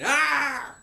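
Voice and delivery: high, loud yell of "ah"; sudden high-pitched cry; loud mock scream. Finn cut off by a scream.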